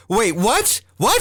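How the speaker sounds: high surprised wait, what; a jolt of disbelief; mock-shocked double take; startled. Startled at the suspicious sponge.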